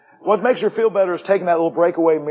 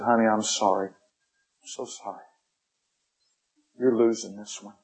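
soft, almost whispered sympathy; gentle doctor; 5 semitones lower, 7 dB quieter than usual. Retold or imitated. Doctor to grieving wife, soft near-whisper. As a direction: hush it down, breaking the news gently. Doctor breaks bad news.

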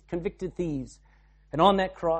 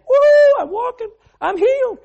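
far higher and louder, whooping; joyful healed man; 12 dB louder than usual. Acted. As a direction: burst out like a man who can walk. Healed man voiced jubilant.

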